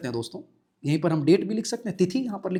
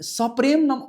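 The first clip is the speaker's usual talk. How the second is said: high mock greeting; 7 semitones higher than usual. Shown as a demonstration. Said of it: "उसके बाद बोले".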